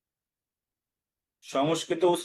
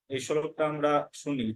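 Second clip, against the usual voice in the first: ordinary talk, nothing marked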